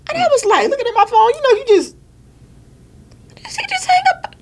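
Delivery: high, incredulous voice; can't believe he just hung up on her; stunned disbelief. Retold or imitated. Staring at the phone after the hang-up, high stunned disbelief.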